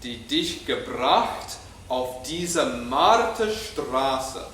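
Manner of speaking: Marterstraße drawn out with both halves emphasized; slow spoken German, leaning on Marterstraße; stressed Marterstraße. Shown as a demonstration. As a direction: model the emphasis for the choir to copy. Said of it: "put the emphasis in the, in your scores where I'm doing it".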